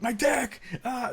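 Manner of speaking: high yelp of the words in pain; mock anguished yell; pained shout. Shown as a demonstration. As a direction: blurt it out hurting. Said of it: "Just be like".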